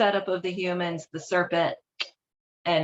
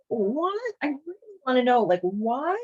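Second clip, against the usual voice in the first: high, disbelieving what; baffled, half-laughing disbelief; real bemused surprise; incredulous; 6 semitones higher than usual. Real, not acted. Incredulous reaction to sacrifice story.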